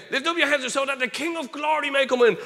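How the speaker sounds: high, loud urgent recitation; proclaimed psalm. Retold or imitated. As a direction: shout the psalm over the horn. Acted loud psalm prayer over shofar.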